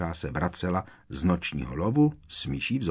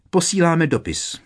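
higher, louder quick reply; raised, ready-made answer; prompt answer in unison; 9 semitones higher, 7 dB louder than usual. A narrator's reading. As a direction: blurt the excuse at once. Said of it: "odpověděli jakoby jedním hlasem".

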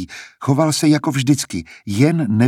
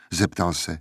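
plain reading voice

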